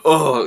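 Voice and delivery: sudden mock groan from the crowd; imitated audience groan. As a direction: mimic a tense audience reacting aloud. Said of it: "I could hear people kinda going".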